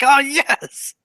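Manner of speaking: high, loud delighted cry; excited. Acted. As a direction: burst out with joy. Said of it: "Brug is excited and is like".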